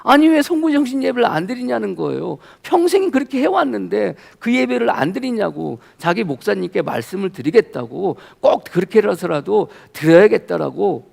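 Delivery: high-pitched mock indignation; retold angry protest. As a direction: imitate an outraged friend demanding answers. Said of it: "엄청 막 화를 내는 거예요".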